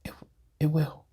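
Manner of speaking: whispered aside, breathy and secret. Real, not acted. Vlogger whispering to the camera, a hushed aside. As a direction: drop to a whisper for a private admission.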